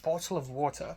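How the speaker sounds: restrained RP British with sounded Ts; understated proper British "bottle of water"; received pronunciation British accent. Shown as a demonstration. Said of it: "actual British accent".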